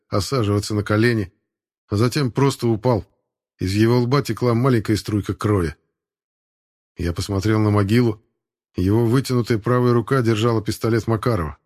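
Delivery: usual speaking voice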